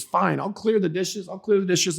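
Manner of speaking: put-on comply grudgingly, grumbling like an annoyed kid; grumbling preteen. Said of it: "mumbling and grumbling".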